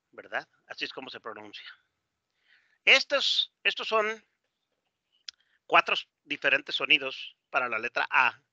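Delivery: ordinary talk, nothing marked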